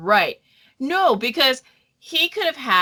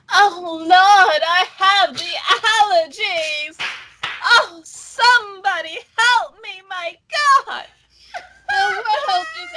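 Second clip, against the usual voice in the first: mock hysterical wailing; 7 semitones higher than usual. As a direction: wail like a melodramatic prima donna. Mocking drama queens' hysterics.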